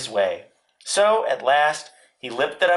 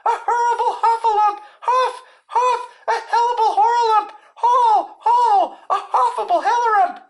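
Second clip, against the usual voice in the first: very high, panicked squealing; Piglet crying out; 5 dB louder than usual. A narrator's reading. Piglet scampers off as hard as he could, squealing in panic. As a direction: squeal it, words tumbling into muddle. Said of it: "still crying out".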